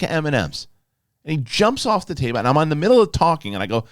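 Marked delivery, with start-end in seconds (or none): none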